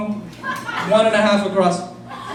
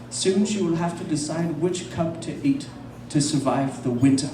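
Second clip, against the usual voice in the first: low, soft, slow nature narrator; David Attenborough; 3 semitones lower, 7 dB quieter than usual. Retold or imitated. Documentary narrator over polar bear scene, low soft hushed narration. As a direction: hush it like a wildlife documentary. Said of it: "hear David Attenborough's voice going".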